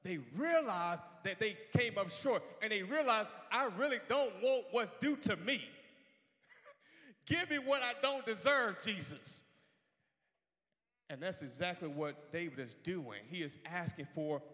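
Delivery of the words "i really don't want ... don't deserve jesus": higher-pitched, acted begging for mercy; fervent plea. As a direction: plead it out in full preacher fervor.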